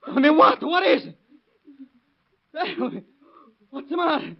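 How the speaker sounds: high, urgent begging; panicked pleading. Acted. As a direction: demand the truth desperately. Father begs to be told.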